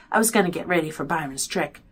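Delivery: plain reading voice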